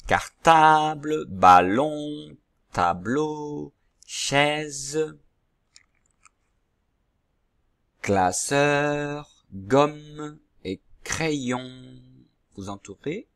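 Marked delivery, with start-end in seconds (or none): 0.1-12.0 slow, carefully spoken word list; name each picture slowly for the children; slow naming. Shown as a demonstration. Teacher naming pictures slowly to pupils. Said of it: "je vous dis le nom de tous ces dessins".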